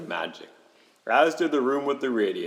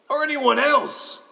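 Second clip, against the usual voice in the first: high, raised exclamation; emphatic spirit; 14 semitones higher, 4 dB louder than usual. Acted. Spirit chiding Scrooge, high and emphatic. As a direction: push it up high and punchy.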